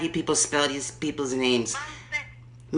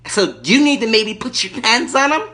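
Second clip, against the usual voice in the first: high, loud irate lady caller; Erkenbacher; 5 semitones higher, 4 dB louder than usual. Acted. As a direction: play the furious entitled lady on the phone. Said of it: "Erkenbacher calling back".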